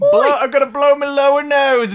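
cheery Cockney showman voice; hammed-up London Cockney; Cockney accent. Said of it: "full of the Britishisms today".

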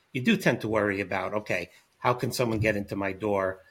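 normal talking voice

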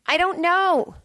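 high-pitched cry of bafflement; exasperated repeat. Real, not acted. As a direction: pitch it up helplessly.

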